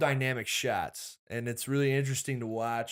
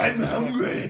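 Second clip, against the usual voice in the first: higher, louder hungry monster voice; Cookie Monster voice; 10 semitones higher, 5 dB louder than usual. Pretend. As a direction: play Cookie Monster begging for food. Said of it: "You'd have the Cookie Monster voice".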